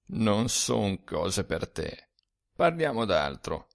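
speaks low and slow, leaning in; a hushed, secretive aside. A narrator's reading. Dead friend hushes the drunk narrator, low and slow. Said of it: "cominciò a parlarmi a bassa voce".

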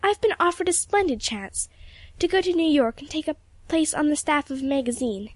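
put-on high-pitched, appealing girlish voice; earnest girl. A narrator's reading. Girl asking old friend about an offer, earnest. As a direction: ask for advice earnestly, pitched well up.

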